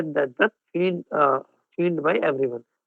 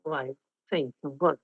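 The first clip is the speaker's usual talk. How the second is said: reduced volume; 4 semitones lower, 8 dB quieter than usual. Shown as a demonstration. Trainer showing students how to speak softer, much softer. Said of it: "I will reduce my volume".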